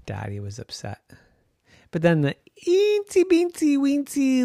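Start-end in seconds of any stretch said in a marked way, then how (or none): none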